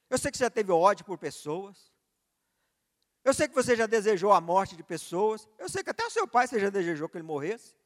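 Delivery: loud, rising sermon proclamation; preaching at full force. Acted. Preacher voicing God confronting the son, preaching at full force.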